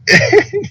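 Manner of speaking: short high-pitched laugh; high little burst of laughter. Real, not acted. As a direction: laugh at your own story. Laughs after his own retold joke.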